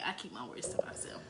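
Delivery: breathy secret whisper, no voice. Shown as a demonstration. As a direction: whisper the line under your breath. Repeats line, then whispers it.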